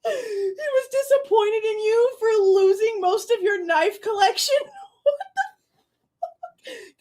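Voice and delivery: can't get the words out for laughing; laughing hard. Real, not acted. Reacting to the disappointed remark, laughing in disbelief. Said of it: "I'm laughing so hard".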